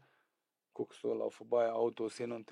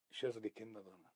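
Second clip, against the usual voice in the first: ordinary talk, nothing marked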